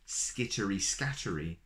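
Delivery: plain reading voice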